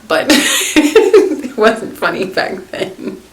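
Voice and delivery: laughing through the words, slowly. Real, not acted. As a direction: chuckle out the whole line. Laughing about sister's scare.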